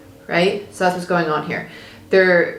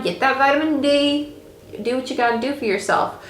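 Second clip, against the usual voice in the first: playful coaxing; 5 semitones higher than usual. Acted. Cheery pep talk to get outside.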